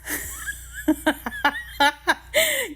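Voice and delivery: real high giggle; embarrassed laugh. Real, not acted. Laughing at her own yawn on camera, giggling. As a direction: laugh it off, caught out.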